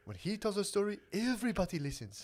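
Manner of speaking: proud storyteller in an African immigrant accent. Shown as a demonstration. Comic shows off his one impression, African accent. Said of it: "It's an African immigrant speaking English".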